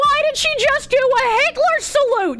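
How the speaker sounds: high outraged cry; shocked disbelief. Real, not acted. Outraged reaction.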